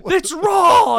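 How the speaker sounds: high, very loud outraged shout; explosive mock kitchen tirade; hammed-up chef yell. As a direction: bellow it like a furious TV chef, for laughs. Acting out chef yelling on show.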